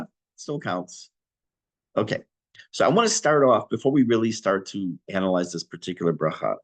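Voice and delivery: ordinary delivery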